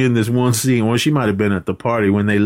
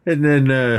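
ordinary talk, nothing marked

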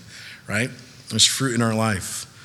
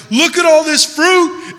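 much higher, louder, slow showing off; mock bragging; 8 dB louder, slower than usual. Vain believer showing off his fruit, slow and boastful. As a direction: gloat over your fruit. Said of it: "be like".

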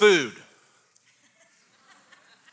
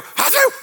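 high, loud mock sneeze; 4 dB louder than usual. Pastor acting a coworker sneezing, loud mock sneeze. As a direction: fake a big sneeze. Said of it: "So they would go".

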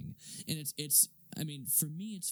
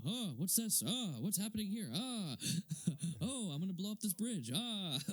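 high, hammed-up bewildered voice; mock clueless Gordon; 6 semitones higher than usual. Mocking Gordon lost in the Batmobile, high and bewildered. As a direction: play him totally lost at the controls.

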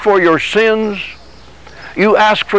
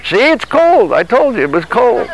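higher, mock-whining complaint; whiny body; 5 semitones higher than usual. Preacher voicing his body whining about the cold floor. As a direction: sulk like a body that wants bed.

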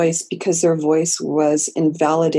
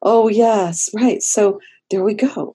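higher, lightly surprised oh of realization; a mock light-bulb moment, half-acted; dawning realization; 4 semitones higher, 3 dB louder, slower than usual. Client suddenly realizing, to the therapist, a touch surprised.

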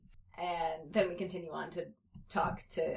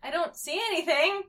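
high-pitched, louder denial; acted high innocent kid; lying kid; 10 semitones higher, 5 dB louder than usual. A narrator's reading. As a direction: deny it in a high child voice. Child lying to his friend.

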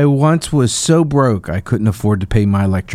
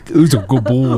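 talking to a dog. Acted.

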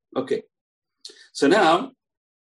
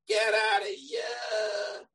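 long, drawn-out yell, higher pitched; mock screaming; 7 semitones higher, 3 dB quieter, much slower than usual. Shammai throwing out the would-be convert, drawn-out mock scream. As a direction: act out a rabbi yelling someone away, stretching each word. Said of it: "Shammai started screaming at him".

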